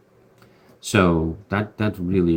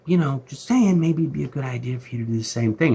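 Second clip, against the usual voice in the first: higher, hinting, a bit fast; mock servant voice; 8 semitones higher, 4 dB louder than usual. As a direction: lean in and drop a heavy hint. Servant voiced nudging Micah.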